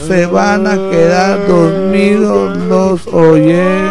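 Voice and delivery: slow, drawn-out sleepy talk; slow motion. Pretend. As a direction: stretch each word out lazily. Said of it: "me está hablando muy lento".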